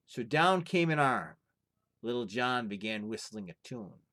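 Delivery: lively storyteller reading a folktale aloud; story narration. A narrator's reading. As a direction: tell it like a fireside tale, full of drama. Reads folktale aloud to viewers.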